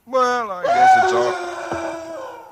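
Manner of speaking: high, drawn-out screaming cry; terrified scream. Acted. Husband cut off mid-sentence by the noise, screaming in terror. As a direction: break into a scream of pure terror. Terror interrupts him at midnight.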